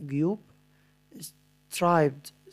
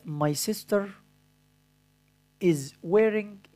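dictating; 4 semitones higher, much slower than usual. Shown as a demonstration. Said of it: "أنا دلوقتي همليك بعض الكلمات".

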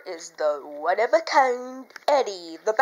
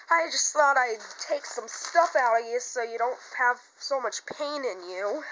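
normal talking voice

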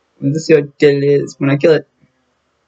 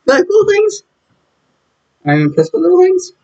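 squeaky voice. Retold or imitated. Squeaky voice while watching cartoon.

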